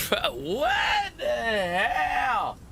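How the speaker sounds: high, stretched-out laughing disbelief; slow squeaky what-the-hell; laughing shocked cry. Real, not acted. Another sub gift interrupts him.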